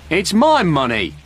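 high, drawn-out protest; shouting. A narrator's reading. Frank protests as the sergeant keeps the money, shouting. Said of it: "shouted Frank".

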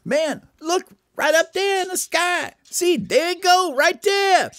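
very high, loud cartoon bird; high-pitched alarm to the others; excited Mexican bird. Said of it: "the Mexican bird says".

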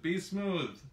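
ordinary delivery

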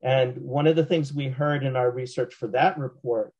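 ordinary talk, nothing marked

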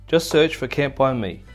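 usual speaking voice

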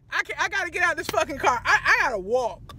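normal talking voice

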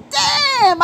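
a high startled damn of disbelief. Retold or imitated. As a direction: yelp it out, shocked by the helicopters. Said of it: "I was like".

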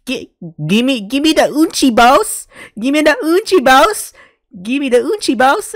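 high, loud squeaky mockery; mock character voice. Streamer mocking the anime thug, high and loud. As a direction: ham up the villain's demand.

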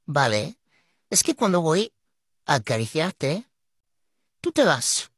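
ordinary delivery

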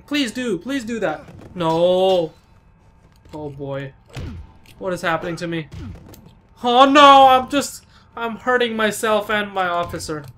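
ordinary talk, nothing marked

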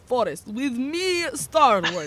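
lightly put-on Swedish host voice; a hint of mock Swedish, hammed up; fake Swedish accent. Restarting the video intro in a light mock Swedish accent. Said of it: "I was going for Swedish".

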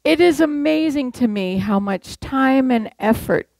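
ordinary talk

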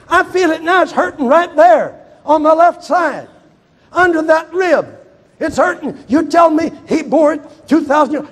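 high, loud mocking doubter; scoffing skeptic. Doubter mocking the preacher's healing claim, scornful. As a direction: sneer at the claim.

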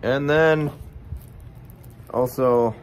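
talking around pipe stem; speaking with clenched teeth; pipe in mouth. Real, not acted. Seen 1: Pipe stem clenched in teeth throughout; absent in all reference frames.

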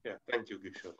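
faint voice, far from the mic; barely audible. Real, not acted. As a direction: speak so low it barely carries. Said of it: "Your voice is very low".

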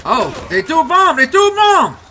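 panicked shout. Real, not acted. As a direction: yell the warning in panic. Panicked shout at an incoming bomb.

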